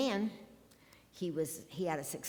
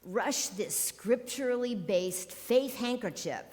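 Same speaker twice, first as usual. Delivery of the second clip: slow, higher-pitched, emphatic preacher pitch; televangelist spiel; 5 semitones higher, 3 dB louder than usual. Acted. Quoting a TV healer's fundraising spiel to the congregation, mock televangelist. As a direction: draw out the healing-cloth sales pitch. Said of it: "one of his spiels went like this".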